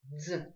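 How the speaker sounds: «Дз» as a single consonant; low, soft «Дз» said as one sound; one fused sound. Shown as a demonstration. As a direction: blend д and з together in «Дз». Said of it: "читается как один звук".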